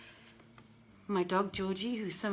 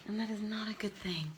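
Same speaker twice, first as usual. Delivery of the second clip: whispered quick aside; hushed joking aside; 6 dB quieter, faster than usual. Real, not acted. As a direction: drop to a whisper, half to yourself. Aside about the bright light.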